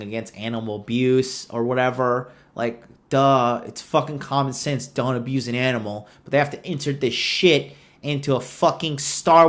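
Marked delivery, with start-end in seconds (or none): none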